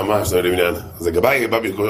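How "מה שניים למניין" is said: low, mildly affronted retort; mildly offended. Retold or imitated. Offended rabbi questioning the minyan count, mildly offended. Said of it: "אז הרב נפגע".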